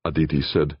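ordinary delivery

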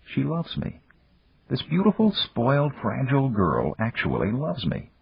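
high, hushed inner voice; marveling. A narrator's reading. Man's inner voice realises she loves him, marveling. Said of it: "a voice inside his head marveled".

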